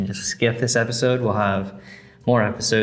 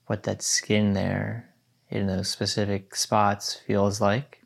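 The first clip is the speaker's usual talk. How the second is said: guided meditation. Acted. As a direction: guide them gently into stillness.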